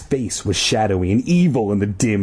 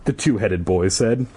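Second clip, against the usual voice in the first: usual speaking voice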